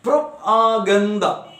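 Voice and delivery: "Propaganda" slowly split into separate beats; syllable by syllable. Shown as a demonstration. Teacher demonstrating polysyllabic words, syllable by syllable. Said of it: "Listen, some polysyllabic words".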